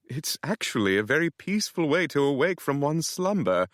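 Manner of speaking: hint of a cheeky British houseboy; sly British butler. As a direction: tease it politely in a British lilt. Nigel the houseboy speaking.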